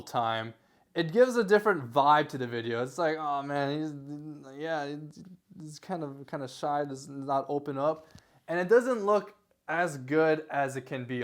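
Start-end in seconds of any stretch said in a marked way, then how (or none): 3.2-8.1 judging mutter. Retold or imitated. Viewer judging a shy video.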